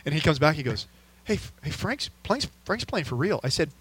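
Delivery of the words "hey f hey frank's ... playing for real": lightly voiced kid, higher and softer; complaining son. Son coming back to dad after getting trucked, mild complaint. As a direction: imitate a boy's softer, higher protest. Retelling his son's complaint.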